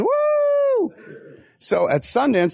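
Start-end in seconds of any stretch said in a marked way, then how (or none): 0.0-0.9 high falsetto whoop; mock cheer. Imitating the wrap-party crowd, high whoop. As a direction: whoop like the cast cheering each credit.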